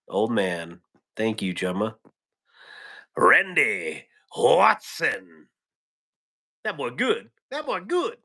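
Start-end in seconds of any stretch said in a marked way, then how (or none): 3.2-5.5 very slow, swooping announcer-style movie impression; movie quote impression. Streamer riffing on a viewer's surname, drawn-out movie impression. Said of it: "What movie was that from?". 6.6-8.2 high-pitched, hammed-up film quote; movie character impression. Streamer quoting a movie to chat, high comic impression. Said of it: "What movie was that from?".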